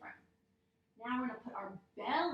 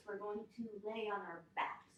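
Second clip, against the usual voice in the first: ordinary talk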